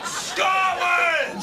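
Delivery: high, drawn-out war cry; hammed-up patriotic roar; defiant shout. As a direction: bellow it like a battle cry.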